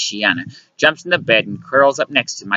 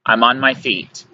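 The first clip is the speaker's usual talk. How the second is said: usual speaking voice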